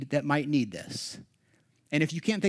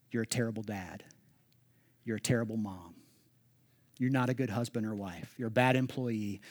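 accusing inner voice; 5 semitones lower, 4 dB quieter than usual. Retold or imitated. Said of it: "voices in our head".

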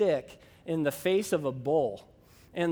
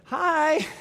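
strained greeting; 9 semitones higher, 3 dB louder than usual. Retold or imitated. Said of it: "by the tone of her voice that something wasn't right".

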